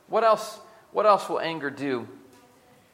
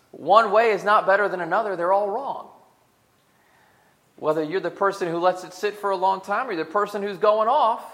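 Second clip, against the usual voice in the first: forceful preaching; 6 semitones higher, 10 dB louder than usual. Real, not acted. Preacher hammering home the point.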